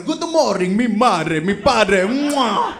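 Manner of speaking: hammed-up Italian kid greeting, ending with a kiss; Italian accent. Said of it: "talks like an Italian".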